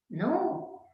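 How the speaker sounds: ordinary talk, nothing marked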